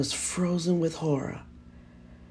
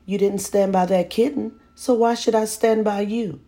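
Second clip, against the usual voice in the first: higher, louder mocking taunt; taunting mother; 6 semitones higher, 5 dB louder than usual. A narrator's reading. Mother taunting her son in the dark. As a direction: needle him with the question. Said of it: "she asked tauntingly".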